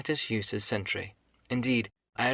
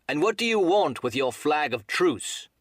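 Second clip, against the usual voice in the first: higher-pitched, challenging call; crying out; 6 semitones higher, 4 dB louder than usual. A narrator's reading. Captain turns to the mutineers, challenging cry. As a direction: demand it across the yard. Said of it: "he cried".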